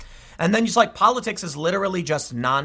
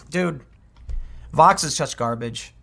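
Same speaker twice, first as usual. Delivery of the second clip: usual speaking voice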